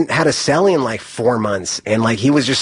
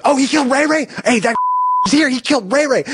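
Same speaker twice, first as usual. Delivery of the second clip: mock yell; 12 semitones higher, faster than usual. Inmate yelling at the new arrival, high mock shout. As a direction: shout the accusation across the cellblock, higher. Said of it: "someone else yells".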